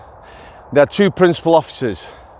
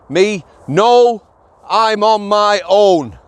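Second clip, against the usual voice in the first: higher, slow, over-emphatic mock reply; exaggerated brusque refusal; 5 semitones higher, much slower than usual. Shown as a demonstration. Officer refusing a prisoner's request, in exaggerated emphatic tones. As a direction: hammer out each word as a hammed-up refusal. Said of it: "I didn't say it like that".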